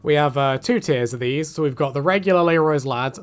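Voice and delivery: normal talking voice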